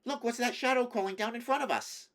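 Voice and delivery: high, loud, quick and excited; great excitement. A narrator's reading. Edmund spots a shadow ahead on the path, greatly excited. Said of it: "he said in great excitement".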